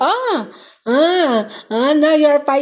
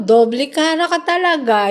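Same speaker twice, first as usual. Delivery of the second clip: normal talking voice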